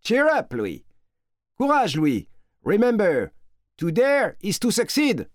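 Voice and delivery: high-pitched shouted encouragement; cheering them on in a high, carrying voice; calling out. A narrator's reading. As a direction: call out a rousing send-off to someone far away. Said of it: "en criant".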